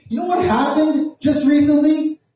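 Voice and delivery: high-pitched, combative rant; acted-out tirade at the cops; full confrontation mode. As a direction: launch into an outraged lecture, pitch way up. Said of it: "this is when I go full".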